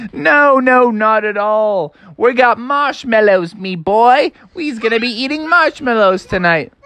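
high, laughing jovial wolf; laugh, then reassure with chummy cheer. Acted. Wolf promises frogs marshmallows instead, jolly.

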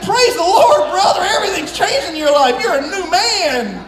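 high, loud, laughing congratulations; excited praise. Retold or imitated. Preacher retelling how he praised his friend, laughing and loud. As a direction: celebrate him like a changed man. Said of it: "I said".

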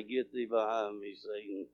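very low, hushed murmur; whisper under the breath. Retold or imitated. Man at work secretly rebuking Satan, whispered under his breath. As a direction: drop far down, barely audible. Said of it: "I'll look and whisper right under my breath".

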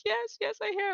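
high, breathless eager reply; eager high yes. Retold or imitated. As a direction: answer as if swept up in the moment. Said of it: "And they'll be like".

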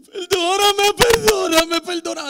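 high, very loud wailed apology; hammed-up tearful begging in public; loud crying plea. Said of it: "en voz alta y todo". (súplica llorosa a gritos, exagerada)

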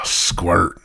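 usual speaking voice

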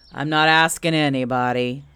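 loud, low-lying donkey grumble; grumpy Eeyore. Acted. Eeyore rebuffs Owl's advice, grumpy and loud. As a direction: rebuff glumly with some force.

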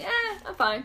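high-pitched, offhand shrug of a line; dismissive. Retold or imitated. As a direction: brush it off lightly. Brushing off the stab wound.

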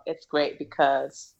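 ordinary talk, nothing marked

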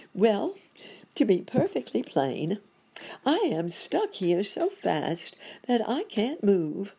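high, emphatic character voice; put-upon monkey doctor. Acted. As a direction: own up as a stuck monkey, high-pitched.

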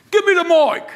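put-on loud, high English accent; exaggerated English accent call; English accent demand. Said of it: "he was from England".

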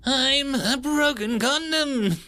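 high slow nasal silly voice; high nasally voice. Acted. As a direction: squeak it out nasally. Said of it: "high-pitched, nasally voice".

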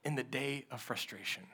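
low, hushed sermon voice drawing the church in close; hushed preaching. Acted. Pastor hushing the congregation about wanting to quit, hushed preaching. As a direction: preach it softly, almost confiding.

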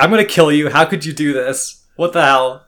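hammed-up angry yell at a rival; mock angry yell. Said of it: "Naruto fucking yelling".

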